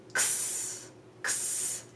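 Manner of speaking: "X" as a back-of-mouth k plus s; voiceless "ks" for "X", s held on; letter X sound. Shown as a demonstration. Teacher explaining how X is made, voiceless "ks". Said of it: "with a little bit of a S".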